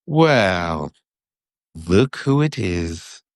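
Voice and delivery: slow, lazy sneer; Malfoy drawling at his enemies. A narrator's reading. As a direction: drawl it out, unimpressed. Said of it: "with his usual lazy drawl".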